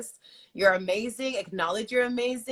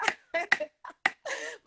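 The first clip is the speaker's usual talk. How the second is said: laugh; 7 semitones higher, 4 dB quieter than usual. Real, not acted. Laughs after singing.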